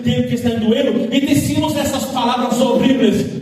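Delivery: loud, ringing sermon delivery with rising intensity; full-force preaching. Acted. Pastor preaching to the church, loud and fervent. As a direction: preach it out to the congregation.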